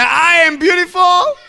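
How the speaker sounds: very high, loud rallying shout; rousing call for repetition. Shown as a demonstration. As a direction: shout it to get a bigger echo. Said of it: "now all the girls say".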